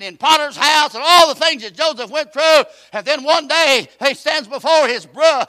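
loud, high preacher climbing to a shout; full-force preaching. Acted. Preacher urging the congregation, loud and rising. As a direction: drive it home from the pulpit.

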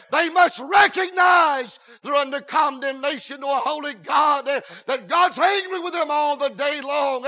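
loud, hammered preacher cadence; full-force preaching. Acted. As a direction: drive it home to the pews. Preaching on condemnation.

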